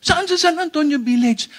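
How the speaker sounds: high, pushy follow-up question; nosy questioner. Acted. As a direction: pester them, voice pitched high. Acting a persistent questioner. (mataas at mapilit na pagtatanong)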